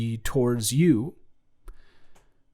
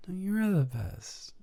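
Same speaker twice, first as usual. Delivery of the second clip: same high gushing compliment, dropped much softer; corny compliment, softer; 8 semitones higher, 7 dB quieter than usual. Acted. Lover answering the compliment battle, softer mock coo. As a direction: coo it back, losing steam. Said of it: "Corny im-, corny compliments battle".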